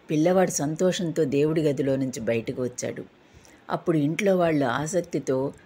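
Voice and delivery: ordinary delivery, nothing marked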